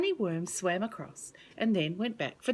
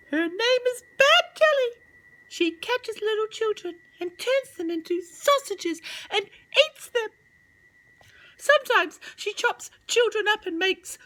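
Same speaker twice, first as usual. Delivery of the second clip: much higher, tiny bird voice; little robin; 14 semitones higher, 8 dB louder than usual. Acted. Robin in a tree warning the children, high and chirpy. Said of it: "said a little robin".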